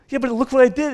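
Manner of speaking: high protesting whine; guilty believer. Retold or imitated. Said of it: "It's like, uh".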